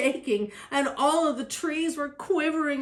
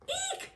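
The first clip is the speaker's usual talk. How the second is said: tiny very high squeak of fright; high-pitched little shriek; startled eek; 10 dB quieter than usual. A narrator's reading. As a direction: squeal thinly, as if scared of a mouse. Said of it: "shouted the elephant".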